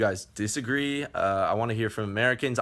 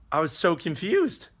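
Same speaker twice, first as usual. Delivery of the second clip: confused; 9 semitones higher than usual. Retold or imitated. Retold shock at café.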